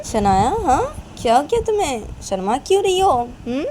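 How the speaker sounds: high, loud singsong questions; hammed-up motherly prodding; teasing mother. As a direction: needle the shy girl with swooping questions. Mother keeps teasing shy girl.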